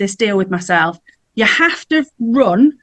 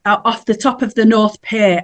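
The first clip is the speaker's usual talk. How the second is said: ordinary delivery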